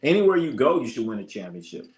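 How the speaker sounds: ordinary talk, nothing marked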